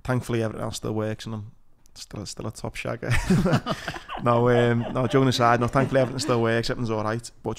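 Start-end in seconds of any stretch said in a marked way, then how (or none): 3.2-4.2 high, loud burst of laughter; hearty laugh. Real, not acted. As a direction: laugh at your own joke. Laughs after own joke.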